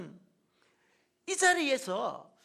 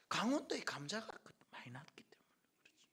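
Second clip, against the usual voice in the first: a quick whispered answer to himself; whispered aside; 4 semitones lower, 8 dB quieter than usual. Real, not acted. Pastor answering his own question: a soft whispered aside. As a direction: drop to a whisper and answer it yourself.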